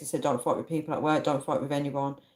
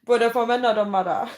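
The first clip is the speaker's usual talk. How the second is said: higher-pitched put-on Jamaican accent; playful Jamaican accent; 5 semitones higher, 4 dB louder than usual. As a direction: slip into a jokey Jamaican accent. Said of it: "I'm stuck in the Jamaica".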